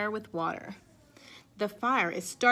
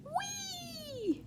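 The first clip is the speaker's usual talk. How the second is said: long high whee down the slide; gleeful whee; 9 dB quieter than usual. Acted. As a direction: squeal it like sliding down. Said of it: "Callie yells as she glides down".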